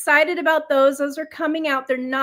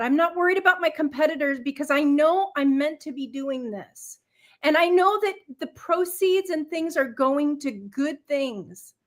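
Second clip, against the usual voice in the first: usual speaking voice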